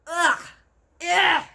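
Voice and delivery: loud, high retching roar; disgusted giant roar. Acted. Bloodbottler gagging on the Snozcumber. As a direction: spit out the taste with a yell. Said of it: "roared the blood bottler".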